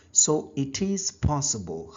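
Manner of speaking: ordinary talk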